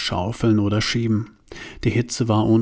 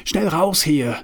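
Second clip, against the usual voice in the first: urgent call; 7 semitones higher than usual. Acted. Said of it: "rief Bruno".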